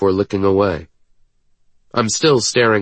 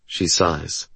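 ordinary delivery, nothing marked

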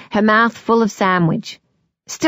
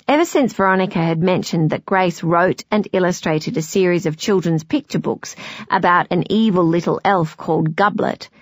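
ordinary delivery, nothing marked

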